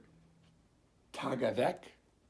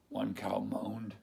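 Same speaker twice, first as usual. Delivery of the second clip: usual speaking voice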